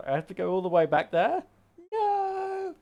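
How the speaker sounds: high, loud, hammed-up disbelief ending in a squealed no; mock outrage. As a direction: fake horror at the long trip back, laughing. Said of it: "But no, I kid".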